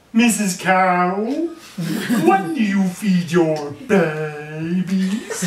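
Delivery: high, loud and slow, mouth stretched wide; a baby frog asking Missus Cow, wide-mouthed, high and loud. A narrator's reading. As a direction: stretch every word out like a frog with a huge grin. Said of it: "'Cause he was a wide-mouthed frog, that's the way they talked".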